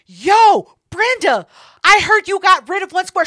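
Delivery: loud, high call-out. Retold or imitated. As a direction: stand up and call her out in front of everyone. Standing up calling [name] out.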